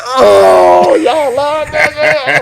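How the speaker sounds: high, loud mock shout of delight; excited outburst. DJ recalling the theater cheering his corny joke, loud excited shout. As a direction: bellow it out like the crowd just roared for you. Retelling his reaction at the premiere.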